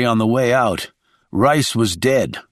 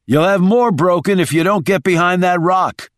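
raised, higher-pitched urgent warning; shouting back; 6 semitones higher than usual. A narrator's reading. Shaw warning wounded Rice to reach the rock, shouting. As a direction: press him hard to get into cover.

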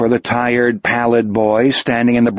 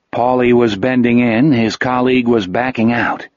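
ordinary delivery

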